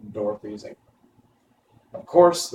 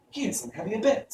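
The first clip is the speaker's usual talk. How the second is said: put-on eager, higher girl's voice; 11 semitones higher than usual. A narrator's reading. Dorothy to the stork, eager and higher. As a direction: press the point eagerly. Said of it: "said Dorothy eagerly".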